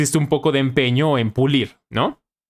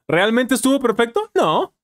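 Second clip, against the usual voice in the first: laughing disbelief; 9 semitones higher than usual. Real, not acted. Mocking his own praised pronunciation.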